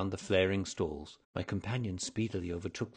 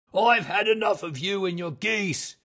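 high, loud shout of a fed-up trader; angry shout; 12 semitones higher, 10 dB louder than usual. A narrator's reading. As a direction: roar at a pest. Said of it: "he shouted".

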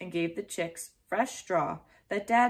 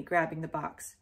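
plain reading voice

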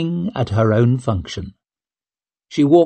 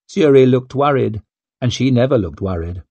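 plain reading voice

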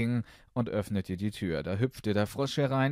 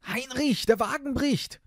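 alarmed call; 5 dB louder than usual. Acted. Prince calls out alarmed.

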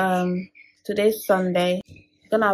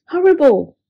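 higher, louder cry of dismay; dismayed dog; 6 semitones higher, 6 dB louder than usual. Acted. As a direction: exclaim the word in horror. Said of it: "Wolf Dog".

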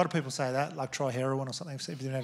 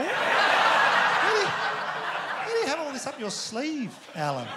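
high, slow, cracking up mid-question; laughing so hard the words stretch out; laughing disbelief; 11 semitones higher, 5 dB louder, slower than usual. Real, not acted. Comedian laughing in disbelief at Alan's crocodile plan.

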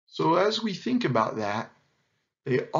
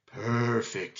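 purring lion; 3 semitones lower than usual. A narrator's reading. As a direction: purr it like a big cat. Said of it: "he purred".

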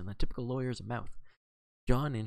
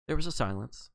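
ordinary delivery, nothing marked

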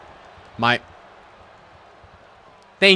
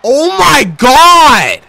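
slow, very high, loud outraged shout; drawn-out shout; 13 semitones higher, 16 dB louder, much slower than usual. Real, not acted. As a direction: stretch out a howl of disbelief. Shocked at game event.

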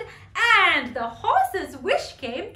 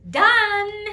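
high, loud magical proclamation; commanding unicorn, high and loud; 4 semitones higher, 8 dB louder than usual. Acted. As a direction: grant the wish with a ringing call. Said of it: "came the voice of the unicorn".

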